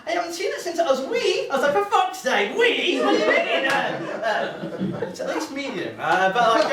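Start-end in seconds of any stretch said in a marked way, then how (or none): 0.1-1.5 very high, flustered imitated auntie; shocked auntie. Auntie answering the heckler about her nephew, shocked high voice. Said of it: "my auntie was shocked. She was like". 1.7-3.7 high, loud comic indignation; mock indignant outburst. Nephew objecting to his auntie saying wee, mock indignation.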